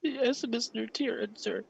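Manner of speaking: put-on high, small, timid child voice; boy meekly obeying the adventurer. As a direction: answer like a scolded boy.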